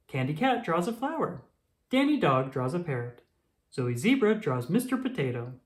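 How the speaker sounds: ordinary delivery, nothing marked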